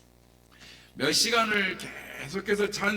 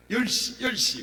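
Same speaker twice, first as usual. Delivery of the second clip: eager answer; 8 semitones higher, 5 dB louder than usual. Retold or imitated. Demon answering.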